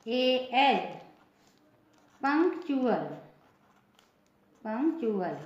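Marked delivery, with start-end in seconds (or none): 2.2-5.4 पंक्चुअल as "pank-chu-al"; T read as CH. Shown as a demonstration. Teacher modelling the UAL rule for learners, पंक्चुअल with "ch". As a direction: say पंक्चुअल with "ch" after the k. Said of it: "टी का उच्चारण च".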